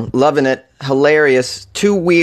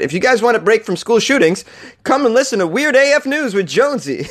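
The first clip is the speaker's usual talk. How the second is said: high-pitched, hammed-up radio advert; sing-song announcer pitch, played for laughs; mock show promo; 9 semitones higher than usual. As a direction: sell it like a cheesy station promo. Mock promo for his own show.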